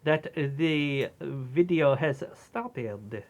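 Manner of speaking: put-on low, slow Belgian detective accent; a pompous Belgian sleuth accent. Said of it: "We were just doing a bit".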